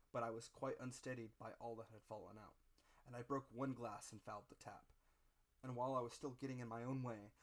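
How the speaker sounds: ordinary delivery, nothing marked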